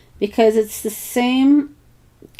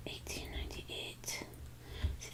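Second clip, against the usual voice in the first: soft breathy whisper of the date; murmured under the breath while writing; 25 dB quieter than usual. Real, not acted. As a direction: whisper it to yourself. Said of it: "Why am I whispering?".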